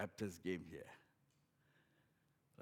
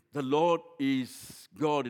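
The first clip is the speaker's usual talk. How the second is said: emphatic proclamation; 5 semitones higher, 12 dB louder, much slower than usual. Acted. Quoted verse proclaimed emphatically.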